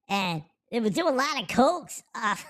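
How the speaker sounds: ordinary talk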